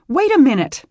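high, loud anxious cry; anxious call. Acted. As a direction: call out to stop him. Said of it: "Beth called".

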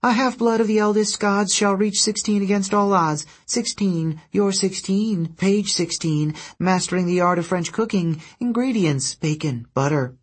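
high-pitched harpy reciting by rote. A narrator's reading. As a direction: reel it off to the sky in a high creature voice. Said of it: "started reciting to the clouds".

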